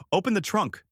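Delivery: a high, pushy command; demanding. A narrator's reading. Cop ordering the driver to open the trunk, demanding. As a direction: bark it at him like an order. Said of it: "he demanded".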